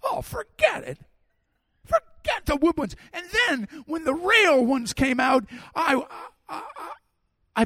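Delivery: high-pitched, hammed-up dread; mock panic. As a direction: squeal in comic terror, ending in a stammer. Acts out dread of wooden coasters.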